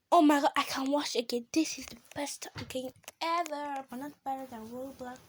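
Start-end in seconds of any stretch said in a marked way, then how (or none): 1.5-5.2 softer, whispered praise; whispered aside. Real, not acted. Gamer whispering an aside to viewers, hushed. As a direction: confide it quietly.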